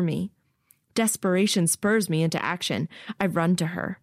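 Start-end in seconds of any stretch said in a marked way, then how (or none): none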